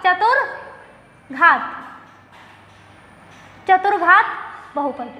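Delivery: चतुर्घात drawn out, split into चतुर and घात; teacher spelling out चतुर्घात part by part; slow dictation. Shown as a demonstration. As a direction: say चतुर्घात very slowly, pausing before घात. Teacher dictates the term in parts.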